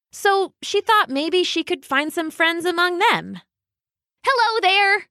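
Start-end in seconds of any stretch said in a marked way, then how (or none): 4.2-5.1 bright and cheery. A narrator's reading. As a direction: chirp it brightly. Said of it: "doing her best to sound bright and cheery".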